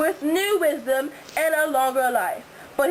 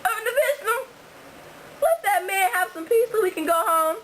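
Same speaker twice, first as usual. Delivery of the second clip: high pleading wife voice; 7 semitones higher than usual. Acted. Wife in the play begs Utnapishtim to wake Gilgamesh, high pleading. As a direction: beg him, pitched up.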